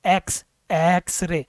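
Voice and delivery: एक्सरे said slowly, opening on a clear e sound. Shown as a demonstration. Teacher isolating the first sound. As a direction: stretch एक्स to show the vowel. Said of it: "ए से आवाज़ चालू हो रही है".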